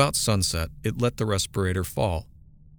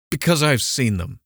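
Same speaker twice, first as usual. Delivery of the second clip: louder, higher outburst; exploding; 5 semitones higher, 5 dB louder than usual. A narrator's reading. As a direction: burst out at her in frustration. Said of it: "he exploded".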